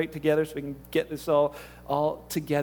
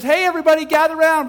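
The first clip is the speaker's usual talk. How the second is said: loud, high town-crier call to the crowd; girl calling out to a crowd, loud and high; herald calling out; 8 semitones higher, 9 dB louder, faster than usual. Retold or imitated. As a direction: shout it like a little herald gathering people. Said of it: "their little herald going through and says".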